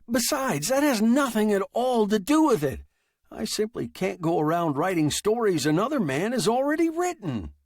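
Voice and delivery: high, loud, indignant protest; heated protest. Acted. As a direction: argue back in outrage. Fred argues with machine.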